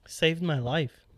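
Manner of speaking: usual speaking voice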